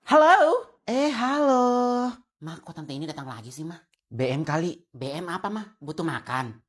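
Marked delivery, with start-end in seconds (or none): 2.4-6.6 whispered gossip. Acted. Gossiping about aunt softly.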